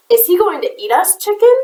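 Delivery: high, rising squeak; a frightened little squeak. A narrator's reading. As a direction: voice jumping up high on chicken. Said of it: "squeaked Jack".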